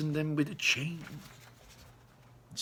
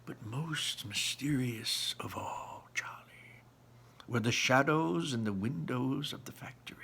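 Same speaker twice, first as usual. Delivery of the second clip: softly; 2 semitones lower, 5 dB quieter than usual. Acted. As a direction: draw the boy in with a mysterious whisper. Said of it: "And he said softly".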